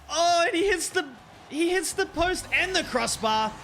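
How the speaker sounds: sportscaster's high-pitched outcry; excited commentary. Real, not acted. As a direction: exclaim at the near miss. Shot hits post and crossbar.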